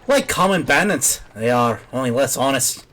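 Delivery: put-on light Irish accent on the old peddler. Reading the peddler's lines to the lass, light Irish accent. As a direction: talk like a folksy Irish trader. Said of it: "giving an Irish accent to the guy".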